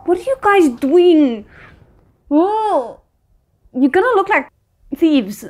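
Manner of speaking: exasperated call-out. Real, not acted. Streamer scolding game characters.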